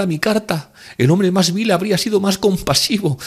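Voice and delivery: high, insistent woman's voice; distraught pleading. A narrator's reading. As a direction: beg desperately for an explanation. Distraught woman begs for an explanation.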